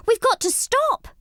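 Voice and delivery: a mouse yelling on a runaway boat. A narrator's reading. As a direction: yell to stop the boat. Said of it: "yelled Angelina".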